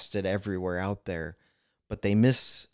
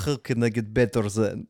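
louder, put-on Russian accent; 5 dB louder than usual. Imagined Russian asking how to improve, mock Russian accent. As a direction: imitate a Russian speaker asking for help. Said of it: "if a Russian comes to you and says".

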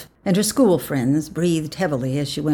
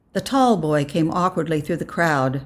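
ordinary delivery, nothing marked

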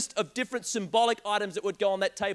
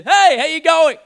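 much louder, higher, blaring friendly hello; too loud greeting; 7 semitones higher, 14 dB louder, slower than usual. Retold or imitated. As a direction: boom a cheery greeting at a sleeping stranger. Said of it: "I've got a bit of a volume perception problem".

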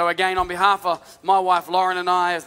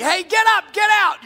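urgent command; 12 semitones higher, 5 dB louder than usual. Pretend. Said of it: "So it starts off real specific".

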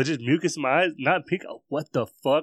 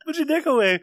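high mock yell; 5 dB louder than usual. Mocking the startled guy.

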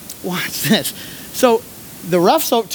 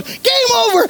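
ref's call. Retold or imitated. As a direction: call it out with finality. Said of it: "And the ref goes".

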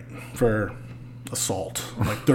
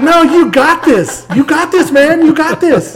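much higher, louder, slow breezy brother voice; mock carefree brother; 8 dB louder, slower than usual. Brother waving him off while dancing, hammed-up high breezy voice. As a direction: ham up the brother who won't help. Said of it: "he's like".

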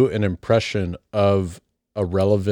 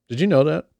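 lightly imitated higher-pitched questioning voice; Eleanor; 6 semitones higher, faster than usual. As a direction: lift it high, a hint of mimicry. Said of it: "I just said that like Eleanor".

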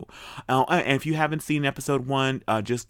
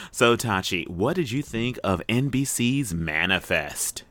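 ordinary talk

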